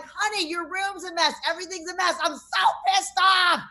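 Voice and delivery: exasperated mom yell, building to the end; hammed-up angry mother; exaggerated retold yell. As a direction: start scolding, then erupt in mock fury. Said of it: "I didn't really yell like that".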